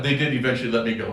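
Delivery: ordinary talk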